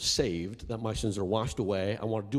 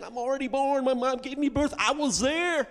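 incredulous; 9 dB louder than usual. Retold or imitated. Mock confusion about born again.

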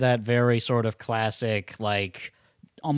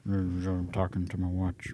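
low-key mock action hero; a hint of gruff Harrison Ford; Harrison Ford impression; 4 semitones lower, 4 dB quieter than usual. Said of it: "My Harrison Ford drifted".

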